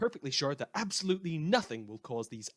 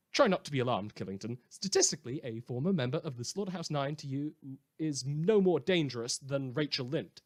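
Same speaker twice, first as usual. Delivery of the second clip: ordinary talk, nothing marked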